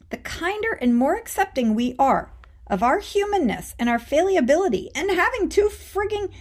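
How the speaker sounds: normal talking voice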